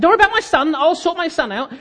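high-pitched exasperated brush-off; acted irritation. Customer to checkout assistant about his son, irritably dismissive.

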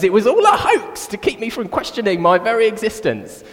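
high, loud mock-dramatic cry; outraged cockerel. Cockerel seeing the sun rise anyway, high hammed-up outburst. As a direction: blurt it out like a cartoon bird having a crisis.

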